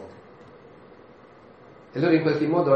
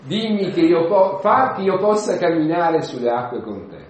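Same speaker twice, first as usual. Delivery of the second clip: loud, higher-pitched bold request; impetuous Peter; 4 semitones higher, 6 dB louder than usual. Acted. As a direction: ask the master impulsively, at full voice. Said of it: "nella sua irruenza". (richiesta irruente ad alta voce)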